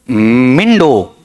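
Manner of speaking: "मिंडो" drawn out very slowly like a ram's bleat; animal sound. Retold or imitated. Teacher imitates a ram for a memory trick, slow bleat. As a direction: stretch "मिंडो" long, a mock animal call. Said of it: "ऐसा बोलते हैं ना जानवर".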